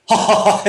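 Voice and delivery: one breath pushed out in ha-ha laughter; drawn-out ha breaking into staccato laughs; laughing-breath demo. Shown as a demonstration. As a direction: demonstrate a forced laugh on one exhale. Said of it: "five full breaths of".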